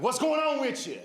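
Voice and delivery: high, lively old-lady impression. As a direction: play an older woman greeting with big energy.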